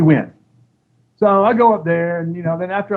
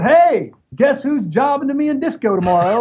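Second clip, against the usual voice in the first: guns a-blazing; 9 semitones higher than usual. Retold or imitated. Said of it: "walked in with guns a-blazing".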